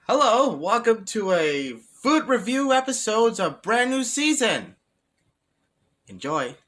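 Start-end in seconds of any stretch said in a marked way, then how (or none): 0.1-4.8 slow, loud, high show intro; high announcer voice. Acted. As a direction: announce the episode in a squeaky voice.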